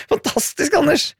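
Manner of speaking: high-pitched, laughing exclamation; amused cheer through laughter; laughing delight. Real, not acted. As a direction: crack up while praising the listener. Delighted laughing reaction to listener message.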